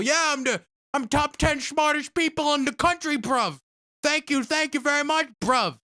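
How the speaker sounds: high, loud mock cockney boasting; British bloke impression. The British man boasting to him in the store, high mock cockney. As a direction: puff up like a smug British geezer. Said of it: "another British person".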